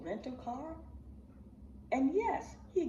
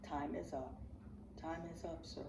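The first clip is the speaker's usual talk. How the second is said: very low and soft; hushed final warning; cold ultimatum; 4 semitones lower, 9 dB quieter than usual. Real, not acted. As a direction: say it like a quiet verdict. Quiet ultimatum to Umar.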